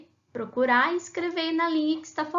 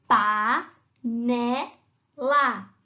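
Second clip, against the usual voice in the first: "pá, né, lá" each firm, with gaps; separate syllables; 4 dB louder, much slower than usual. Shown as a demonstration. Teacher prompting pupils to guess the word, syllables spaced apart. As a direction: punch out "pá, né, lá" one by one. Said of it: "com essas três sílabas".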